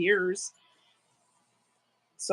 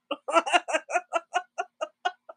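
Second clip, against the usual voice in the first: high, rolling real laughing fit; hard laughter; 7 semitones higher than usual. Real, not acted. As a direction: dissolve into giggles at the punchline. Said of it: "I'm laughing so hard".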